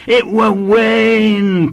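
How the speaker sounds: high, loud, drawn-out insistence; persistent small boy. A narrator's reading. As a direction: dig in like a stubborn schoolboy. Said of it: "persisted the child".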